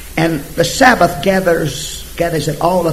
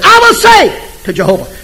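high, loud declaration; firm proclamation; bold affirmation; 13 semitones higher, 7 dB louder than usual. Retold or imitated. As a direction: declare it boldly. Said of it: "He just said".

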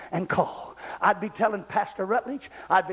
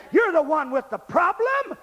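raised, urgent preaching shout; full-force preaching; 7 semitones higher, 4 dB louder than usual. Real, not acted. Preacher pushing the intimidated, full-force exhortation. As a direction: exhort them with a high holler.

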